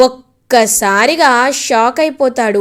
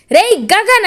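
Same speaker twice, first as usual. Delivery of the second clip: angry shout; 8 semitones higher than usual. Acted. Father storming in, shouting for the rival by name, furious shout. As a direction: call him out with a fierce shout. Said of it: "గట్టిగా అరుస్తారు".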